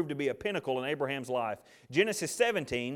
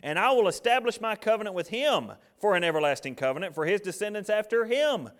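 loud, raised-pitch firm pronouncement; emphatic; 4 semitones higher, 4 dB louder than usual. Acted. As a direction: read God's answer with emphatic, punched force. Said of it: "look how emphatic he is".

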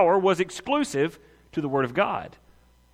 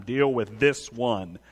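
low, slow acted command; grave, measured order; low, deliberate command; 6 semitones lower, 3 dB quieter, slower than usual. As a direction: drop the pitch and space out each word. Repeats the Spirit's command to himself.

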